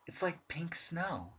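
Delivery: soft, slow and hushed, in awe; a diver murmuring at pink snow, awestruck; hushed wonder. Acted. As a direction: breathe it out in wonder. Edmund marvels outside.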